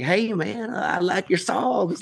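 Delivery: lightly imitated slow, soft Texan drawl. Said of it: "he's got this awesome, like, Texan drawl".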